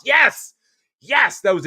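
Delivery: loud, high cries of yes; excited agreement. Real, not acted. Host to viewer who got the idea, excited agreement. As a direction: burst out in delighted recognition. Viewer guessed what he intended.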